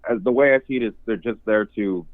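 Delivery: ordinary delivery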